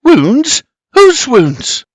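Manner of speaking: high, loud startled questions; alarmed woman. A narrator's reading. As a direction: ask in high-pitched alarm. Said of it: "she asks".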